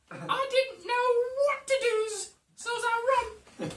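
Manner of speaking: giggly Cockney lass who panicked; Cockney lady accent. Acted. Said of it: "brilliant Cockney lady accent".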